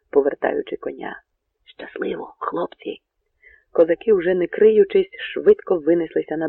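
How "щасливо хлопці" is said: voiceless, hushed whisper; whispered farewell. A narrator's reading. Bohun turns his horse and parts from his men, whispering. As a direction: whisper it secretly to your men. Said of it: "прошепотів Богун".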